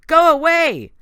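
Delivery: high, very loud command. A narrator's reading. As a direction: power bursting out in a shout. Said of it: "Nico shouted louder".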